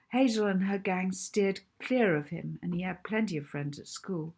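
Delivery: plain reading voice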